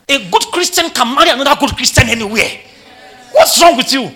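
loud, high, emphatic rebuke; exasperated preaching shout. Real, not acted. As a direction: scold the congregation at full voice.

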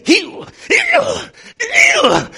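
very high, loud healing commands falling hard; commanding shout. Retold or imitated. Preacher reenacting healing the sick bay, shouting. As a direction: hurl each heal like a command.

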